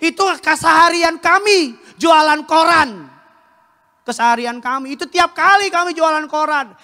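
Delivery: high, loud shouted testimony; impassioned shout. Retold or imitated. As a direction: shout out where you came from. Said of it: "Saya bilang".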